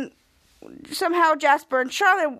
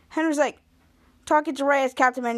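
plain reading voice

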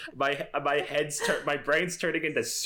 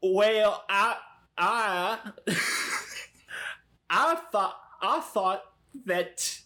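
higher, faltering put-on voice; halting disguise voice; 6 semitones higher, much slower than usual. Player tries Cody's Eloise voice before responding, halting and higher. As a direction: feel your way haltingly into a badly faked voice. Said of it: "I gotta try the voice real quick".

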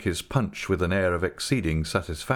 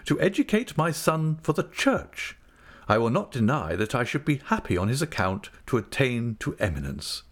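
higher-pitched, orotund speechifying; Mr Micawber, pompous; 7 semitones higher than usual. A narrator's reading. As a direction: declaim your plans with grand deliberation.

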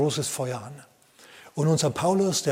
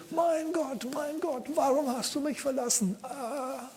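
mock despairing cry; 11 semitones higher, 5 dB quieter, slower than usual. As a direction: play a man who feels abandoned by God. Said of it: "der hätte jetzt gebrüllt". (hoher, verzweifelter Klageschrei)

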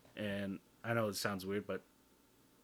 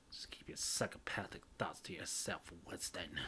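soft, whispery aside to himself; mock whisper, kept low and private; hushed self-scolding; 4 semitones lower, 8 dB quieter than usual. Podcaster telling himself off after nearly yelling, whispered.

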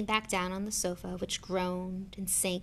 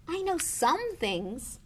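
put-on high, slow, defensive boy; protesting boy; 9 semitones higher, much slower than usual. A narrator's reading. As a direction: protest in a higher child's voice. Harry protests, boy's voice.

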